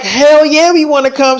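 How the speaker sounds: high, loud acted-out yes; eager cheer. Friends accept Selena's trip invitation, eager cheer. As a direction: jump at the invitation, voice way up.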